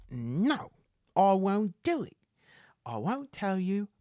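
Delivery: high-pitched character refusal; stubborn beggar. Acted. Beggar resisting intimidation, stubborn beggar.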